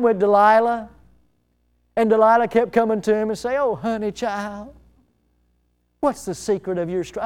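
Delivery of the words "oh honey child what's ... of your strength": lightly put-on Southern accent, soft and coaxing. Delilah coaxing Samson for his secret, light southern accent. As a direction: coax softly with a hint of Southern lady. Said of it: "I think she had a southern accent".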